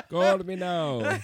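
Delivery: slow, high mock Jamaican accent. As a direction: play a hammy Jamaican psychic ad.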